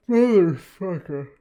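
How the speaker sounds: slow curse through a yawn. Real, not acted. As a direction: groan it out mid-yawn. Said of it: "a trick for keeping myself awake".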